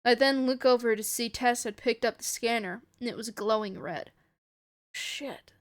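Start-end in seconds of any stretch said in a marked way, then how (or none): 4.9-5.5 hushed, whispered swearing; soft whisper. A narrator's reading. Ray seeing the scanner glow red, soft whisper. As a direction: whisper it under the breath. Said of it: "I whisper softly".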